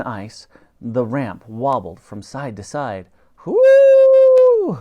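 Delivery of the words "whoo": very high, loud trumpeting whoo; long high animal hoot; mammoth hoot. A narrator's reading. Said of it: "hooted the mammoth".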